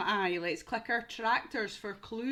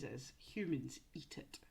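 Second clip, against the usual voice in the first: whispered aside; 8 semitones lower, 9 dB quieter than usual. Real, not acted. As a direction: let them in on a secret. Aside to children.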